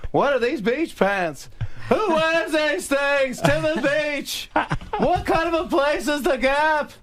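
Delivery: very high, slow exasperated impression; Jerry Seinfeld yelling. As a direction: yell each question slowly in a high comic whine. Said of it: "we're just yelling back and forth".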